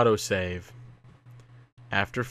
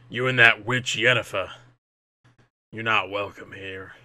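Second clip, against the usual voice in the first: lightly voiced unwelcoming druid; a hint of a stern druid turning you away. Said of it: "That's a druid voice".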